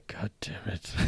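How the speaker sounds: unvoiced, under-the-breath god damn it; whispered curse. Streamer imitating Jonathan losing the bet, whispered curse. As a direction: mutter the curse like a sore loser. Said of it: "He's like".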